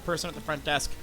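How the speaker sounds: ordinary talk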